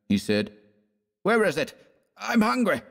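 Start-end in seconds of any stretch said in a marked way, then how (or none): 1.2-2.8 high-pitched put-on keenness; affected eagerness. A narrator's reading. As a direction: sound eagerly hungry, overdoing it. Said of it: "replied Fallowby with affected eagerness".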